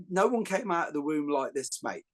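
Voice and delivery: normal talking voice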